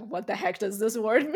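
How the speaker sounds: a laughing cry of confusion; laughing mock outburst. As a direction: burst out laughing in disbelief. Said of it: "be like".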